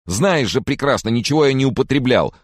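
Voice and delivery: high, loud protest; outraged cry. A narrator's reading. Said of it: "вскрикнул он".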